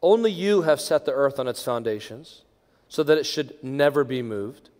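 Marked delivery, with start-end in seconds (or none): none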